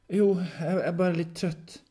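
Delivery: high, loud, stammered excuse; flustered reassurance. Acted. Henrik jolted from vision claiming tiredness, flustered. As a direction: brush off concern, flustered.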